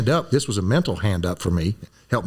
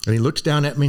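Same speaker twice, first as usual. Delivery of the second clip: ordinary talk, nothing marked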